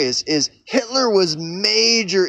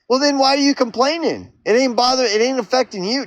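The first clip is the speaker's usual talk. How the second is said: higher, exasperated comeback; incredulous retort; 6 semitones higher than usual. Pretend. Vlogger scoffing back at the imagined believer, incredulous. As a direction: throw the question back in disbelief.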